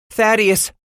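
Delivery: high, anxious mother's voice; whimpering. A narrator's reading. Mother to raging husband, whimpering. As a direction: plead his name in alarm. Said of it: "his mother whimpered".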